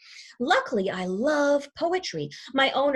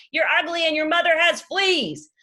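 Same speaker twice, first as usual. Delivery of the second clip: higher, louder cheeky taunt; taunting yell; 7 semitones higher, 6 dB louder than usual. Acted. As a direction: yell the insult down at the dog. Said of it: "he yells down to the dog".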